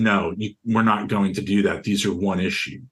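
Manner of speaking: ordinary talk, nothing marked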